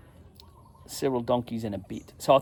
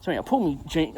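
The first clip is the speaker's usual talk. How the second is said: usual speaking voice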